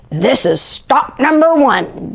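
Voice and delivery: lightly hissed Grinch aside. Acted. The Grinch on the roof, hissing. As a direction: hiss it to yourself, sneaky.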